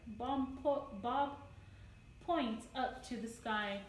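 usual speaking voice

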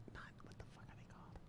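whispered aside to himself; muttered whisper. Real, not acted. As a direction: breathe it under your breath, off-mic.

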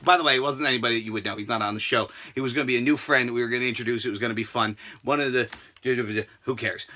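low, fast muttered aside; quick aside. Real, not acted. Explaining to viewers, low fast aside. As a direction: rush this aside under your breath.

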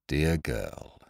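gentle words with a hard edge; a captain softly warning the girl; soft with steel. A narrator's reading. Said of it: "replied Ned softly enough, yet with steel in his voice".

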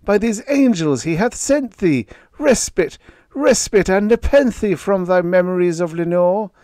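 high and loud, pleading; loud high-pitched fervent plea; crying out. Acted. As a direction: beg the bird at full voice. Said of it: "I cried".

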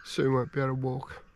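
quiet, soft, choked-up musing; holding back tears while speaking; emotional. Real, not acted. Alone in the bush, telling viewers about his dying dog, softly emotional. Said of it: "I'm feeling a little bit emotional".

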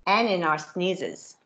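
lively storybook reading with character voices; reading to children. A narrator's reading. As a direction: read it brightly to a class of kids. Reads picture book to children.

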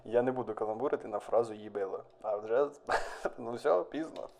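quiet, giggling confession; barely holding back laughter; stifled laugh. Real, not acted. Suppressing laughter at pun. (тихо, стримуючи сміх)

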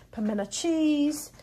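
usual speaking voice

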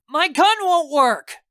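high, loud, slow yell; shouting. A narrator's reading. Narrator warns team the gun has failed, shouting. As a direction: shout it in alarm, drawn out. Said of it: "I shout".

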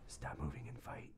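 low hushed whisper; whispered taunt. Pretend. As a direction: hiss it under your breath at the enemy. Whispering at game enemy.

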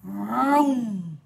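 long, swelling vroom; imitated roaring truck; engine noise. A narrator's reading.

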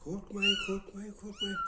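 calm the scared kittens in a high voice; soothing pet talk. Real, not acted. Owner calming the frightened kittens, in a high soothing coo.

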